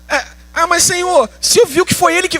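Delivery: high-pitched pleading objection; whiny protest. Acted. Believer arguing with Jesus's command, whiny protest. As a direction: protest back in a high, whining voice.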